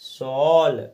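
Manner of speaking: सॉल said loud with a long open aw vowel; loud teaching demo of सॉल with open aw vowel; aw sound demo. Shown as a demonstration. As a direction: stretch सॉल out with a clear aw. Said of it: "ओ की मात्रा लगाकर पढ़ेंगे".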